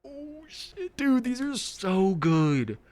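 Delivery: high, loud and drawn out with delight; excited exclamation. Real, not acted. Streamer raving to viewers, thrilled and loud. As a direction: gush over how good the stories are. Reacting to a great story.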